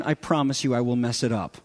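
ordinary delivery

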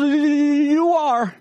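high squeaky little squirrel; lightly voiced squirrel; timid squirrel. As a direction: answer small and scared. Timid squirrel answers lion.

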